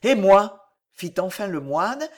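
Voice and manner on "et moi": loud outburst from the monk; frightening voice. A narrator's reading. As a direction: lash out enough to scare a child. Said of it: "d'une voix qui eût effrayé un autre enfant". (voix forte, effrayante)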